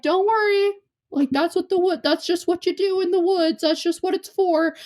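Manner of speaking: high-pitched put-on dad voice, reassuring; imitated dad. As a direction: play the dad brushing it off, pitched well up. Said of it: "the dad was like".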